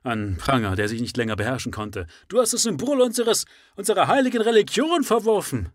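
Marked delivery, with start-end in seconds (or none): 2.3-5.7 high, loud accusing shout; full shout. Acted. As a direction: throw the charge with fury. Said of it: "Das kann besser interpretiert werden".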